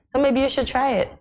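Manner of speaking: ordinary talk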